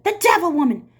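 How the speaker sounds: loud, high accusing cry; brand her in front of the crowd; angry accusation. Acted. Trout accusing the teacher before the mob, loud and high.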